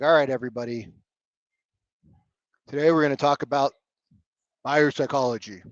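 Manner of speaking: lightly voiced dull presenter, low and lifeless; boring presenter. Shown as a demonstration. Coach mocking a dull Zoom opener, droning and flat. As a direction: drone it like a bored trainer. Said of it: "I don't even know how to be boring".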